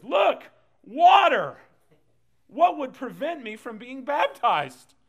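high, very loud, drawn-out cry; hammed-up eager yelling, stretching the words; excited shout. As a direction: burst out like you just spotted it. Acting out the eager eunuch.

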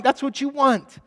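ordinary talk, nothing marked

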